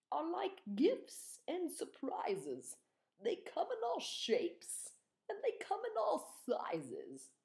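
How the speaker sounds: a king pondering aloud; wise old king. Acted. King's voice, slow and high.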